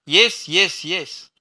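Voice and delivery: loud, high mocking yells; taunting shout. A narrator's reading. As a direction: scream the taunt at full stretch. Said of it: "असं चिडवत ... ओरडत राहिली". (चिडवत, शिरा ताणून ओरडणे)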